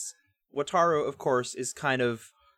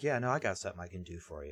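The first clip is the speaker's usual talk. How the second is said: fast, lower, softer sly reply; sly offhand reply; 5 semitones lower, 7 dB quieter, much faster than usual. Retold or imitated. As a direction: rattle it off like a schemer. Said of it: "He's like".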